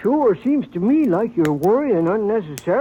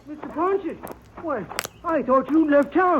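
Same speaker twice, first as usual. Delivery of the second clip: surprised; 8 semitones higher than usual. Acted. Startled banker greets Ponce.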